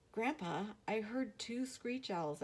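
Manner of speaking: put-on a scared boy telling grandpa; worried boy. Boy alarmed by owl superstition.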